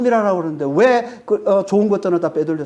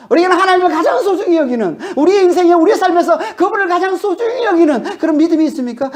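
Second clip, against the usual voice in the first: louder, higher pleading sermon voice; impassioned preaching; 7 semitones higher, 4 dB louder than usual. Acted. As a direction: press the question on the congregation. Preaching builds to climax. (열정적인 설교 톤)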